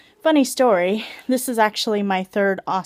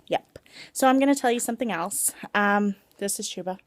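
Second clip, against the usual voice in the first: normal talking voice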